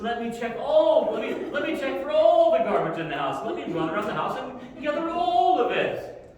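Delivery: put-on high, loud, sugary-sweet kid voice; overeager kid. Pretend perfect kid gushing to mom about the garbage. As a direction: gush like a too-helpful child. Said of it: "ideal world of a kid".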